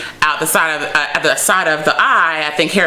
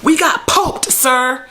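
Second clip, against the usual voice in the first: panicked shout; 9 semitones higher, slower than usual. Retold or imitated. Aide shouting the bad news to his boss, high panicked yell.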